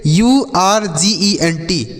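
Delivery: letters of usable spelled out slowly, one by one. Shown as a demonstration. Teacher spelling a word for children, slowly letter by letter. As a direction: read out यूएसएबीएलई as separate letters, slowly. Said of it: "इसे हम पढ़ते हैं अर्जेंट".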